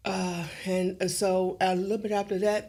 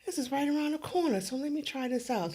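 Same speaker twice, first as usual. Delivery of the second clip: much higher, bright self-talk voice; pep yourself up in a high private voice; pitched up as if muttering to herself; talking to myself; 8 semitones higher than usual. Shown as a demonstration. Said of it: "This is how me talking to myself".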